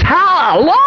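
high, imitated whoop of praise; jubilant exclamation. Dave hears his case is dismissed, jubilant exclamation. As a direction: let out a high-pitched hallelujah.

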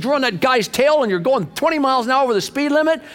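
very high-pitched mock scolding; angry yelling. Highway patrolman scolds a tailgating driver, angry mock yell. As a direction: imitate an exasperated cop yelling. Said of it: "he was ticked".

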